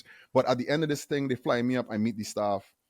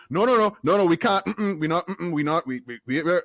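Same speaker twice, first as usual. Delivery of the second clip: louder, higher flustered backpedalling; flustered refusal; 5 semitones higher, 5 dB louder than usual. Retold or imitated. Imitating the HR handler shutting down the hire, louder flustered stammer. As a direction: stammer out a panicked no.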